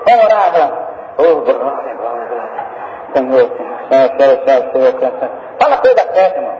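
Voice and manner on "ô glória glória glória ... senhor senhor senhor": low, murmured chant of Senhor; mocking the empty repetitive pray-er; droning repeated prayer. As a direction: drone the word over and over. Monotone repetitive prayer imitation.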